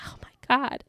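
whispered mock disbelief; breath-only retold exasperation; exasperated whisper. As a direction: whisper it as a fed-up aside. Exasperated aside after grandpa laughs.

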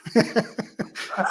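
a quick unvoiced laugh, falling away; amused falling laugh; short laugh. Real, not acted. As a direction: laugh lightly at the joke. Laughs at good place to stop.

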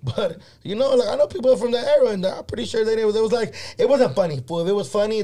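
high and loud, fired up; worked up. Real, not acted. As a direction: push it out with heat. Heated rant about the skit.

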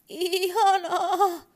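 very high, very loud, slow horse cry; terrified toy horse whinnying wildly, high and loud. Acted. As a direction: scream the word like a frightened horse. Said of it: "hevonen hirnui hurjalla äänellä".

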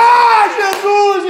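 loud, high, drawn-out imitated wail; retold tearful cry. Preacher as Mary seeing Jesus, hammed-up tearful cry. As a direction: cry out the name, acting Mary in tears. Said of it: "E chorando".

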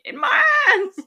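high, loud yell through laughter; laughing shout. Real, not acted. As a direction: yell the answer, cracking up. Shouted punchline while laughing.

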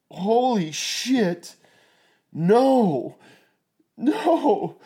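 high, loud, horrified disbelief; panicked cry. Retold or imitated. Retold finding wife bleeding, panicked.

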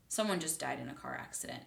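softly retold, matter-of-fact statement; calm announcement. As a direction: say it calmly and quietly. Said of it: "calmly said it to my".

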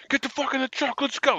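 high-pitched urgent calling out of a name; retold yell. Fellow soldier urgently calling the speaker back to the truck, high shout. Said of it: "he's screaming at me".

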